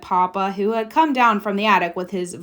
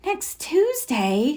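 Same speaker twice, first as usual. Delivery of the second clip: dismayed echo; 7 semitones higher, much slower than usual. Acted.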